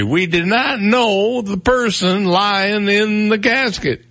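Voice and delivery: high, drawn-out comic impression; Bill Cosby. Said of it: "I sound like I'm doing Bill Cosby".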